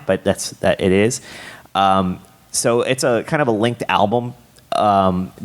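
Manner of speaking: normal talking voice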